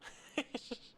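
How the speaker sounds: high wheezy giggle; real high-pitched giggle; laugh. Real, not acted. Streamer laughing at the game, high giggle.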